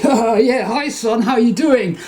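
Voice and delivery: imitating a jaunty ghost father; ghost dad's greeting. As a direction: greet your son like a cheerful ghost. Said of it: "and he's like".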